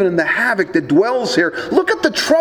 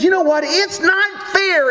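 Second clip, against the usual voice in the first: seething little voice; 9 semitones higher than usual. Retold or imitated. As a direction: hiss it like a sulky devil. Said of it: "in that seething little voice".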